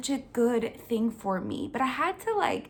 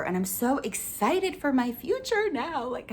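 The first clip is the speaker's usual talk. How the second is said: ordinary delivery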